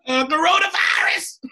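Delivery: high, loud shouted coronavirus. Retold or imitated. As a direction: yell it out, way up high. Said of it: "She just yelled coronavirus".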